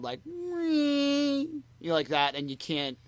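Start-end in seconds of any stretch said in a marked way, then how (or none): 0.2-1.9 long, high-pitched held "eeee"; imitated feedback squeal. Podcaster showing the feedback that hit every sung line, high held squeal. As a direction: mimic a loud mic feedback squeal. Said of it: "like, a loud".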